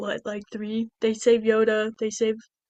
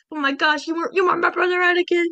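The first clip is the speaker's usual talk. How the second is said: high-pitched melodramatic lament; mock anguish; 6 semitones higher than usual. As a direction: cry betrayal in a hammed-up high voice. Acts out the tragic final duel.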